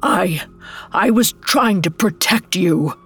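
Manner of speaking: halting false start on the first word; stammering. A narrator's reading. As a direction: stumble into a feeble self-defense. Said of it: "she stammered".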